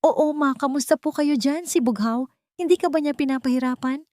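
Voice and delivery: joking son. A narrator's reading. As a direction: tease your mother on the phone, bright and fast. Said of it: "Pabirong tanong ni Bantay".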